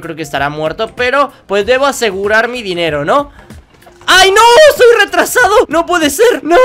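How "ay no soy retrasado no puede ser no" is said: much higher, louder self-mocking yell; frustrated outcry. Real, not acted. Gamer realizes he shot the wrong man, frustrated outcry. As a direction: yell at your own blunder.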